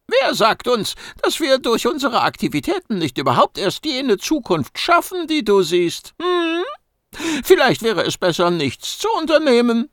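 a small furry know-it-all teasing; cheeky mouse-beaver. Acted. Gucki needling the agent, squeaky high and cocky.

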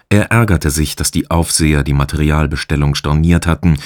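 ordinary delivery, nothing marked

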